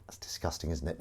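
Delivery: breathy whispered aside; a whispered confession of disgust. Real, not acted. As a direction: drop to a whisper, half to yourself.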